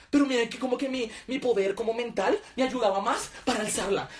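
ordinary talk

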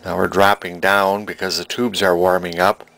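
usual speaking voice

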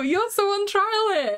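high, drawn-out scolding; slow, high-pitched mock indictment; teasing accusation. Real, not acted. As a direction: accuse with playful outrage, stretching it out. Teasingly accusing co-host over compliment.

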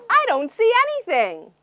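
high quick puzzled princess; cartoon princess. Princess at castle gate.

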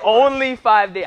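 slow, loud and drawn out; drawn out disbelief. Real, not acted. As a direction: stretch every word in disbelief. Stretched out in disbelief.

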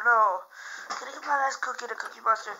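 normal talking voice

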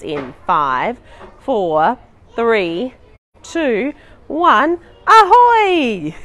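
countdown climbing to a loud ahoy; building countdown. Pretend. Counting down within the song.

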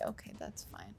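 breathy resigned whisper; letting it go under her breath. Real, not acted. Gamer gives up on finding pigs, whispered.

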